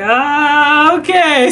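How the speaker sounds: high, drawn-out "ah, okay"; imitating her reluctant okay; retold hesitant agreement. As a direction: stretch it out high, giving in. Retold reply agreeing to the sharing.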